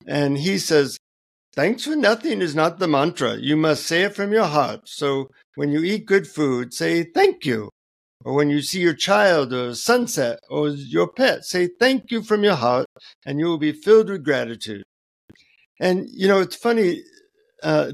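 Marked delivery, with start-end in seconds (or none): 1.6-3.4 put-on higher-pitched Indian-accented guru. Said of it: "in an Indian accent". 3.4-14.8 put-on Indian-accented guru giving earnest instruction. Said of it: "in an Indian accent".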